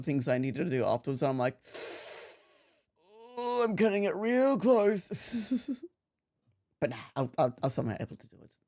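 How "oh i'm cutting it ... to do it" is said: ordinary talk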